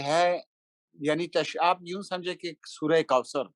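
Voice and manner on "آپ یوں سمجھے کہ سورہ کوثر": ordinary talk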